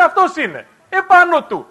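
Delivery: soldiers calling out. Retold or imitated.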